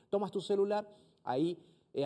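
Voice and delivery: normal talking voice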